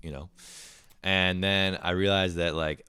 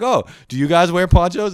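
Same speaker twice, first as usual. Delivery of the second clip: eager high question; 12 semitones higher, 12 dB louder than usual. Retold or imitated.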